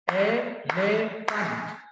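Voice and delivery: "Elephant" very slow, a gap between its three beats; syllable by syllable. Shown as a demonstration. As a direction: pull "Elephant" apart into three long syllables. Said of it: "So there are three syllables".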